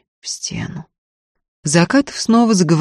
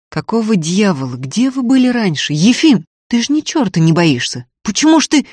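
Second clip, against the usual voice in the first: exasperated demands, pitch climbing; louder, mounting exasperated questioning; angry outburst; 6 semitones higher, 6 dB louder than usual. A narrator's reading. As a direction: burst out, unable to hold back. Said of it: "Взорвался, не выдержав он".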